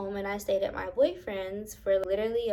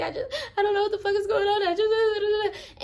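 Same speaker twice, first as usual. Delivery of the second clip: much higher, fast, panicky; panicked rush; 12 semitones higher than usual. Retold or imitated. Retelling her panic to her boyfriend, much higher and rushed. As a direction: babble as if still terrified.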